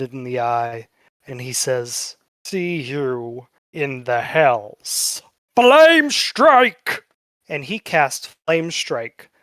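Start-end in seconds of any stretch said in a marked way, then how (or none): 2.4-5.2 very slow, drawn-out villain threat; menacing farewell. Acted. Bested lord staring an enemy dead in the eye, slow menace. Defeated lord's last threat before attacking. 5.6-7.0 high, loud battle shout; shouted spell cry. Acted. Bested lord casting his last spell, high shout.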